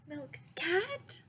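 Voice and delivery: high pleading cat calls, rising each time; begging for a cat. Acted. Child begging parents for a cat, high pleading calls. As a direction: plead like a child who wants a cat badly.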